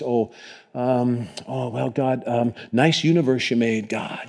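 hesitant, soft mumbled small talk; awkward prayer. Acted. Awkward first-date talk with God, halting and soft. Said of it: "I'm awkward anyway".

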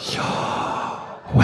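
"Yahweh" as pure breath, inhaled and exhaled, no voice; breathed pronunciation. Shown as a demonstration. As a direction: breathe "Yahweh" slowly, one whispered breath per syllable. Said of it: "breathe the name of God".

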